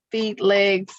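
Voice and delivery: normal talking voice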